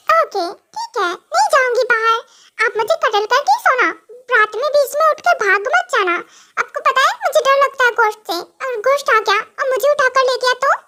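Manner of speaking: mock-fearful little-girl voice; scared childish wife. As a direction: plead like a kid afraid of the dark. Wife scared of ghost at night.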